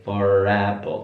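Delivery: "For apple" with the R clearly sounded; R sounded before a vowel. Shown as a demonstration. Teacher demonstrating a linking R to students, careful. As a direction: link the R of "For" into "apple". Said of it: "tetap R-nya dibaca for apple".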